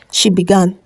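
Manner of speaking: ordinary delivery, nothing marked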